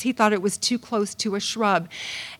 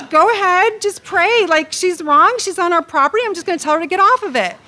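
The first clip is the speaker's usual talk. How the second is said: insistent husband; 6 semitones higher, 5 dB louder than usual. Retold or imitated. Husband insists, retold argument.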